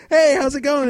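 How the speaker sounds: high, loud mock-friendly hello; overly bright, high mock greeting; cheery greeting. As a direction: imitate a chirpy colleague. Imitates cheery colleague greeting.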